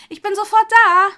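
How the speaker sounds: high, loud call across the room; friendly call. A narrator's reading. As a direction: call out brightly to a customer. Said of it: "rief Hermine ihr freundlich zu".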